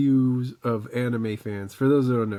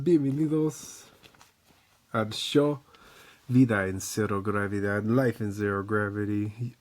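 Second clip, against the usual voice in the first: lucha libre announcer. Acted. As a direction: stretch bienvenidos like a wrestling MC. Show opening announcement.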